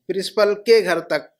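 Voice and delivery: ordinary talk, nothing marked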